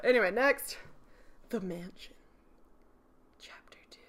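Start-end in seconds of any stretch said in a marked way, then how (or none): 1.5-4.0 breathy seductive whisper, very soft. Shown as a demonstration. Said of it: "Was that too seductive?".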